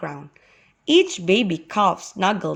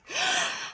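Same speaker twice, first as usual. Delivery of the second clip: high acted gasp; sharp high intake of breath; pained gasp; 6 dB quieter than usual. A narrator's reading. Bitten young man before he speaks, pained gasp. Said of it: "The young man gasped".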